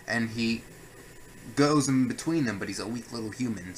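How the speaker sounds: ordinary delivery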